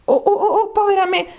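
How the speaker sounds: high, stuttered cry of regret; squeaky, woeful "oh" repeated; dismayed exclamation. Acted. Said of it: "esclamò Alice con un tono dispiaciuto".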